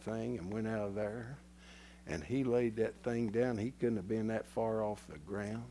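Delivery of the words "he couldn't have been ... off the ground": usual speaking voice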